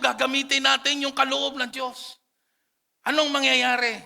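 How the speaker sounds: fervent revival preacher; full-force preaching. Acted. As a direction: preach at the top of your voice.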